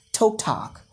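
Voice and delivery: "talk" first as "toke", then as "tahk"; British then American "talk". Shown as a demonstration. Teacher contrasting both accents, "talk" with O then ah. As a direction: switch "talk" from O to ah. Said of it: "in British English as talk".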